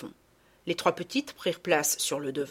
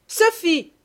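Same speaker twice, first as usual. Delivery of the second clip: much higher, loud angry call; furious call; 11 semitones higher, 8 dB louder than usual. Acted. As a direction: summon the girl furiously. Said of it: "la voix furieuse de madame Ficquini".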